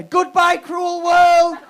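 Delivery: mock suicidal cry, high and loud, half laughing; melodramatic wail. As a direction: wail it like a tragic hero for laughs. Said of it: "And then I went".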